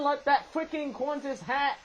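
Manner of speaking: ordinary talk, nothing marked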